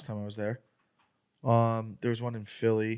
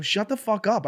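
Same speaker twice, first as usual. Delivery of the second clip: high-pitched retold snap at the heckler; retold angry yell; 8 semitones higher than usual. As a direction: relive telling him off, raised. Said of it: "now we start arguing".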